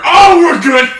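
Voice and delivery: high loud relieved cheer; excited shout. Retold or imitated. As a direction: cheer like rescue just arrived. Teammate joins, relief.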